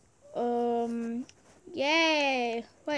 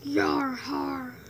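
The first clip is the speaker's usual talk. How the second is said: hearty pirate Yar har, a bit louder; 6 dB louder than usual. Shown as a demonstration. Kid trying a pirate catchphrase for the stream, hammed up. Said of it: "That's not my voice".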